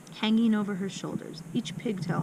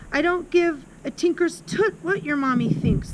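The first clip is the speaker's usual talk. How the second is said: high-pitched, mildly loud dismissal; yelled; 7 semitones higher, 3 dB louder than usual. A narrator's reading. Headmistress dismisses what Amanda's mother thinks, yelled. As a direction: snap back scornfully in a raised pitch. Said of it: "the Trunchbull yelled".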